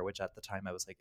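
ordinary talk, nothing marked

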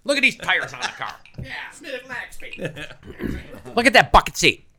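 loud, high, pushy car salesman patter; car salesman pitch. Retold or imitated. Imitating a car salesman pushing a customer, loud and high. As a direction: sell it hard like a lot hustler. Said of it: "everybody trying to get you to drink something talks like this".

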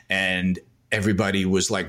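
ordinary talk

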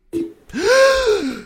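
sharp high gasp at icy water; cold shock gasp. Acted.

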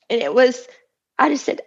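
ordinary talk, nothing marked

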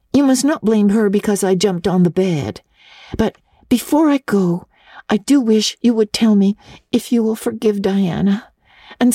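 put-on high, earnest girl's voice pleading a case; an orphan girl defending her friend; eager little girl Anne. A narrator's reading. Anne pleads with Miss Barry for Diana, high and earnest.

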